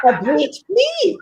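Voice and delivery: high, slow mock-French accent. Shown as a demonstration. Guest playfully mimics co-host's phrase in a French accent. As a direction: stretch FLICK into a French-accented "fleek". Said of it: "it's very French".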